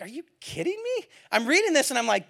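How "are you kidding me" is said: high incredulous are you kidding me; mock disbelief. Pastor retelling his shocked reaction to the email. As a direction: squeak it out in disbelief. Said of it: "And I was like".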